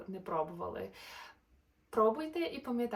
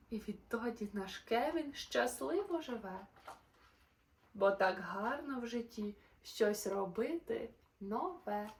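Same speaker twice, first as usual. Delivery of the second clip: lively, sing-song storybook narration; animated bedtime-story voice; reading to children; 5 semitones higher, 4 dB louder than usual. A narrator's reading. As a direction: read the rhymes brightly to little listeners. Reads a rhyming children's story.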